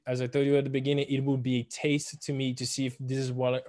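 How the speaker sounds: ordinary talk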